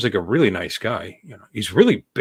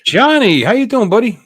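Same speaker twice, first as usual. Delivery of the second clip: high, loud cheerful hello; excited greeting; 11 semitones higher, 5 dB louder than usual. Real, not acted. Greeting a viewer.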